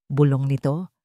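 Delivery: plain reading voice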